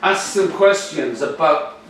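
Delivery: ordinary talk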